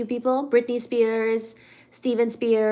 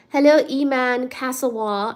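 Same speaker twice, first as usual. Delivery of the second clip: ordinary delivery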